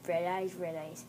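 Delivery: low, soft eerie chant; hushed ghost. Acted. Ghost at the bed to hidden girl, hushed and low. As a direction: intone it quietly.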